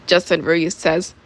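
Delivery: usual speaking voice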